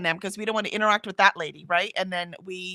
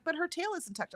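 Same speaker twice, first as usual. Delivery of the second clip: higher, softer mock objection; mimicking the lady's reply, higher and softer; imitating the lady; 9 semitones higher, 8 dB quieter than usual. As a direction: pitch up and pull it back. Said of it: "She's like".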